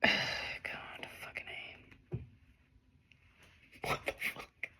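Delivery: whispered curse; stunned whisper. Real, not acted. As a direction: breathe it out in shock. Stunned by the reading.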